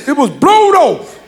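slow, laughing "blowed" with an -ed ending; grandma insisting "It was blowed off"; grandma's blowed. Retold or imitated. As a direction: drag out "blowed off" the way grandma does. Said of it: "She says".

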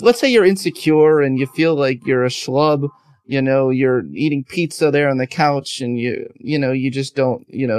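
usual speaking voice

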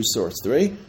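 normal talking voice

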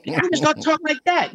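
high comic voice, insisting; Pee Wee voice. Shown as a demonstration. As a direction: push the voice way up into a cartoonish register.

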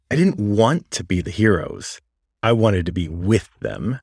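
ordinary delivery

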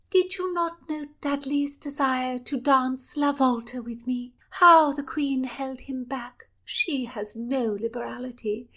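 high gossiping princess with whispered asides; mischievous royal girl. Acted. Princess gossiping about Dudley.

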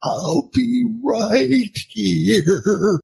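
high, very slow mock-weepy goodbye; hammed-up teary drawl, pitched high; tearful movie farewell. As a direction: imitate a tearful goodbye, stretching every word. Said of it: "his eyes are filling with tears".

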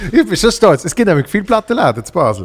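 high, loud and proud. Real, not acted. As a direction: boast about your city. Proud of his hometown.

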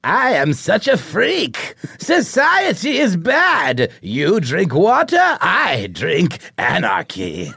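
very high, slow, unhinged clown; Joker voice. Acted. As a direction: stretch each word like a mad clown. Joker speaks in read script.

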